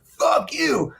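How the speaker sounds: strained high mock shouts; overheard angry curses. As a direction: imitate people hollering from another room. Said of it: "And I hear".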